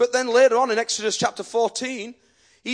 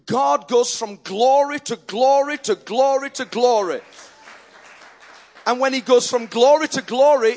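rising, louder preacher's chant on each glory; preaching glory; 3 semitones higher, 5 dB louder than usual. Acted. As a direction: build it up glory by glory. Preacher building on repeated glory.

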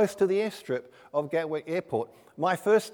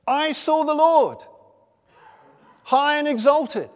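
loud, raised scripture declamation; proclaiming; 7 semitones higher, 6 dB louder than usual. Retold or imitated. Preacher quoting Isaiah's vision in the temple, loud proclamation. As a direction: declaim the prophet's vision, higher and louder.

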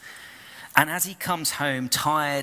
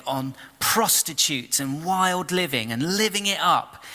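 high, raised, aggrieved outburst; indignant complaint; 6 semitones higher than usual. Acted. Older son rails at his father about the feast, indignant. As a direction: pile on the unfairness. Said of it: "the son's not happy".

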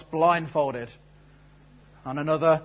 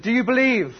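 put-on loud, high go at a French accent; badly faked French showman; half-hearted French accent, pitched up; attempted French accent; 9 semitones higher, 7 dB louder than usual. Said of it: "I can't really do a French accent".

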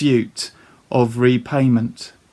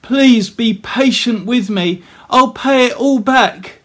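higher, pleading voice of a desperate debtor; begging; 7 semitones higher, 4 dB louder than usual. Acted. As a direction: plead on your knees for more time. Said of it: "begged him".

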